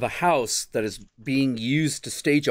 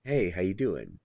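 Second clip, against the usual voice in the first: lightly lowered, casual greeting; a hint of a laid-back guy arriving on set; low-key mock greeting; stunt performer impression; 5 semitones lower, 4 dB quieter than usual. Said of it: "the guy comes on and it's like".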